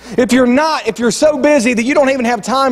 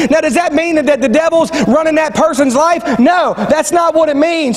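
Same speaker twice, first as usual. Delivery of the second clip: raised-pitch sermon delivery; emphatic preaching; 6 semitones higher than usual. Real, not acted. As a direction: drive the point home to the congregation, drawing out key phrases. Preaches the point with force.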